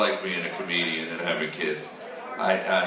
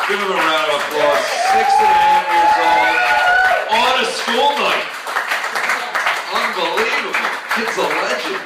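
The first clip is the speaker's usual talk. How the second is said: loud, much higher crowd rally; hype shout; 13 semitones higher, 8 dB louder than usual. Real, not acted. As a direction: comedian shouting to the crowd about his son. Hyping the crowd for his son.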